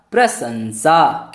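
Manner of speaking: प्रशंसा drawn out slowly, न sounded after श; slow careful pronunciation. Shown as a demonstration. Teacher modelling slowly for students: प्रशंसा, प्र-शन्-सा. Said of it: "उच्चारण होगा न का".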